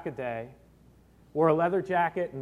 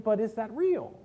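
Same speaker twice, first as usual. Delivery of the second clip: ordinary talk, nothing marked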